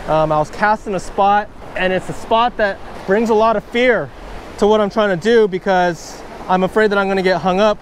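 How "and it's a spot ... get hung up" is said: ordinary delivery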